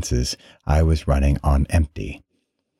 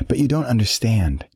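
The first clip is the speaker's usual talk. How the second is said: higher-pitched, restrained frustration; through clenched teeth; 7 semitones higher than usual. A narrator's reading. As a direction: protest it through clenched teeth, at normal volume. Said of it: "said between clenched teeth".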